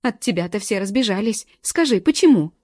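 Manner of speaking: loud, high, bitter screaming; tearful shrieking. A narrator's reading. Galka shrieking accusations at her friend, tearful. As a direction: spit out the hurt as she screams. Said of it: "Галка уже визжала".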